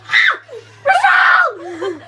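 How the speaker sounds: high, loud, slow laughing yell; laughing shout. Real, not acted. Player yelling at teammate Rochelle, laughing shout. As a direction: cry out her name through laughter.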